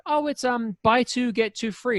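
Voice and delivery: high, slow imitation of the cookie seller; lightly put-on shop worker voice, drawn out; high mock shopkeeper voice; mimicking the shop guy. Staff voiced in retold story.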